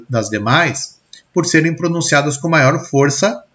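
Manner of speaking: usual speaking voice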